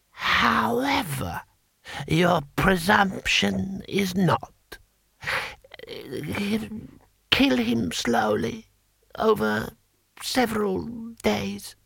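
put-on high, slow, halting old ruler; ancient emperor. Emperor sentencing the courtier, high slow voice. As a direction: savour each phrase with long pauses.

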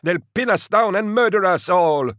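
high, loud panicked yell; terrified driver bellowing at the big man. Acted. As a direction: shout it as if death is certain. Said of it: "Tuvant bellowed".

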